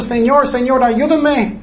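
high, urgent cry for help; tearful plea. Acted. Preacher acts out David praying to God, tearful pleading. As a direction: plead upward in mock-tearful prayer. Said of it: "él estaba llorando, él estaba orando mucho".

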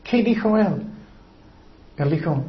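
normal talking voice

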